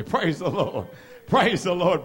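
ordinary delivery

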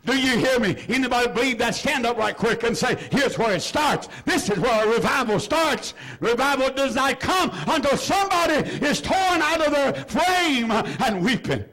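rising, driving revival preaching; preaching at full force. Acted. Preacher rallying the church about revival, full-force preaching. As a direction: fire up the church toward revival. Revival call, raised pitch.

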